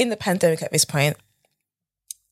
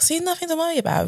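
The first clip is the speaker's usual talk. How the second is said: smug reassurance; 12 semitones higher than usual. Retold or imitated. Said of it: "I was like".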